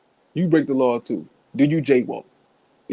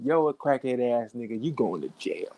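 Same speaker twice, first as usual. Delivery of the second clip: mock tough cop; 3 semitones lower, 7 dB quieter than usual. Mock cop arresting him.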